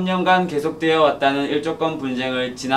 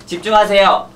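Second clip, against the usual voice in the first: commanding; 5 semitones higher, 6 dB louder than usual. Acted. Commanding attention.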